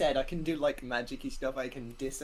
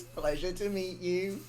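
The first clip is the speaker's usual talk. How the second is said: ordinary delivery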